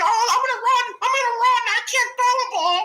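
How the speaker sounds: high, loud, whiny mocking voice; whiny mock Lamar. Mocking Lamar's scrambling, in a high whiny voice. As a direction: whine it like a panicked kid. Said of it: "he go".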